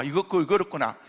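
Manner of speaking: ordinary talk, nothing marked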